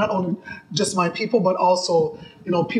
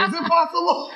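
laughing; 10 semitones higher than usual. Real, not acted. Laughing disbelief.

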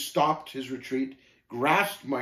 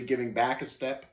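usual speaking voice

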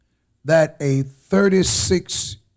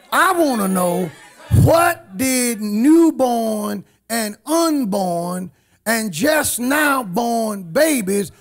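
preaching at full force; 7 semitones higher than usual. Real, not acted. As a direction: thunder it from the pulpit.

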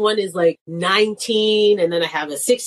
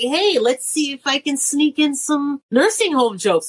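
sly comic aside; 6 semitones higher than usual. Retold or imitated. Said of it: "and I'm like".

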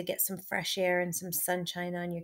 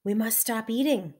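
higher, louder protest from Toad; mild cry out; 3 semitones higher, 7 dB louder than usual. A narrator's reading. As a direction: cry it out, lightly acted. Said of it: "cried Toad".

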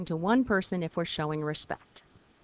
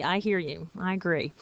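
ordinary delivery

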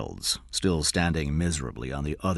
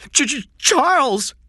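put-on very high, loud boy's stutter; frightened stammer; 9 dB louder than usual. A narrator's reading. Captive boy answers shouted question, stammering in fright. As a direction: stammer the first sound in a child's pitch.

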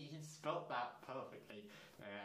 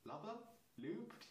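puzzled question; 6 semitones higher, 5 dB quieter than usual. Real, not acted. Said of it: "It should be lubed".

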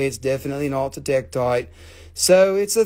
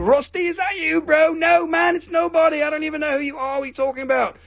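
high-pitched disguised voice; mock-innocent denial, pitched way up; put-on denial voice; 12 semitones higher than usual. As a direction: play dumb in a high, silly voice. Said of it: "I don't talk like this".

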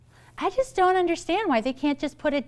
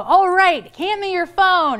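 louder and higher, no-nonsense; firm demand; 7 semitones higher, 6 dB louder, slower than usual. Retold or imitated. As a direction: hold out your hand and insist. Therapist demanding client's phone.

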